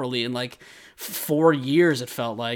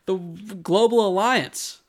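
chirpy, higher mock Armin; breezy and pleased with himself; smiling Armin; 6 semitones higher than usual. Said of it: "he smiled and went".

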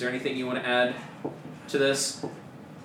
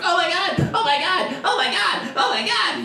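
much higher, louder freaking out; panicked; 6 dB louder than usual. Acted. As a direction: shriek it in panic. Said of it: "Corky's like".

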